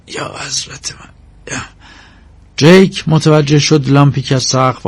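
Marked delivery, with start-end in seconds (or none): 0.1-1.6 very soft, whispered invocation; hushed exclamation. Acted. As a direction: breathe it out under your breath, barely voiced.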